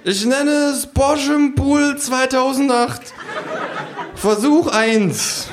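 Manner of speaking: high, slow proclaiming kangaroo voice; pompous kangaroo. Acted. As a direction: announce the title of a masterpiece. Kangaroo names its artwork. (hohe, langsame feierliche Verkündung)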